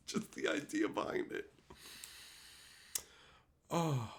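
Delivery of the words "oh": ordinary delivery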